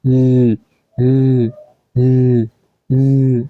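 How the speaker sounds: ল as a sustained "lll"; held L sound. Shown as a demonstration. Teacher modelling the sound of L, ল held as "lll". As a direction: hold ল as a pure L, each one separate. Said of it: "ল এর মতো উচ্চারণ হয়".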